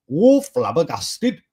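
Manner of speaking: slow, drawn-out Welsh "Oh, flabbergasted"; Welsh accent. Retold or imitated. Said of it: "that's how that would have sounded".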